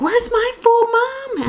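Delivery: high-pitched imitation of a demanding toddler; mock toddler scream without much extra volume. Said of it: "the other one's screaming".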